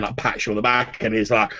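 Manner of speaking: ordinary talk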